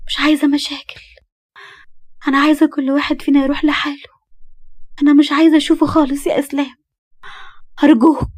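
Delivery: a scared wife begging him to stay out of it; frightened young wife. Acted.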